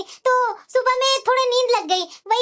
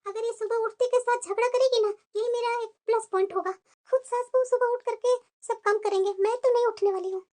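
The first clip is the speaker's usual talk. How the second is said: hushed, plotting to herself; quiet scheming aside; 2 semitones lower, 7 dB quieter than usual. Acted. New bride plotting to herself, hushed scheming. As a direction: murmur the plan so the family can't hear.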